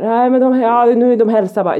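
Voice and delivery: high, loud acted protest; agitated protest. Mum retells arguing with the dog owner, agitated protest. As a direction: re-enact a heated argument about the dogs. Acted quarrel over the dogs.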